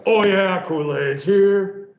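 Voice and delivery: big booming mascot shout; slow, loud Oh yeah mascot call; Kool-Aid Man. Retold or imitated. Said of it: "The Kool-Aid commercials".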